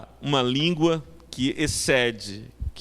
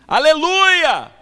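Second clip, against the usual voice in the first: high, long-drawn full-force shout; stretched, belted call for attention; desperate shout; 14 semitones higher, 9 dB louder, slower than usual. Retold or imitated. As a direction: cry it out across a crowded hall. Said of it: "chegou no meio do saguão e gritou".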